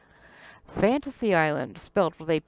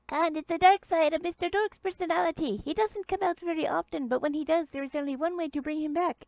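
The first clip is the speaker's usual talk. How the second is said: high-pitched little character; sidekick's small voice; 9 semitones higher than usual. Acted. Dadu explains the cure to the visitors, high-pitched. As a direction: keep it high all through. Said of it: "Dadu turns to you and says".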